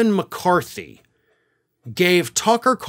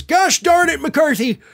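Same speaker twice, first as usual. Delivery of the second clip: hammed-up indignant Mike Lindell; Mike Lindell impression; 11 semitones higher than usual. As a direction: blurt it like a flustered letter opener. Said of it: "My Mike Lindell impression".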